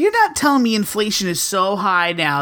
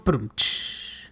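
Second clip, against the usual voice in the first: vocal rimshot; 9 semitones lower, 7 dB quieter than usual. Pretend. Rimshot after a joke.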